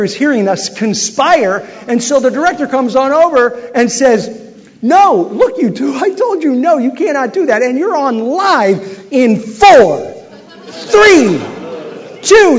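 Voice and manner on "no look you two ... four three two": rising, louder with each number, slow count; urgent countdown. Retold or imitated. Director warning the host and guest they are live, urgent rising countdown.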